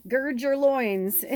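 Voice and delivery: slow, drawn-out and laughing. Real, not acted. Reader laughing as she teases the line to her viewers, slow. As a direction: savor the phrase through a laugh.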